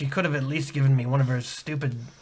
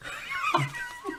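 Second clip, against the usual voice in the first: high villain cackle; 11 dB quieter than usual. Acted. Evil laugh on leaving.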